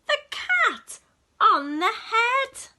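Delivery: high loud slowed ouch; cry of alarm. A narrator's reading. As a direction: cry out as it hits. Cat bopped, narrator cries out.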